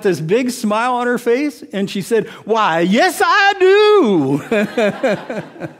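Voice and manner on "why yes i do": imitating a beaming old lady, much higher and louder; joyful, grinning answer in her voice; delighted yes. Elderly woman tells the preacher she knows she's saved, beaming.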